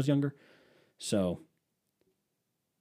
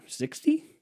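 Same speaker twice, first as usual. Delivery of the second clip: surprised. Real, not acted. Shocked at the number.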